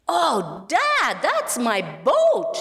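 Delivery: put-on high, eager boy's voice calling to Dad; excited cry. Boy spotting his lost boat in a window, high and excited. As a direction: burst out with delight at spotting it. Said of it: "he cried excitedly".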